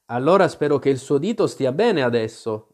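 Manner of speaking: usual speaking voice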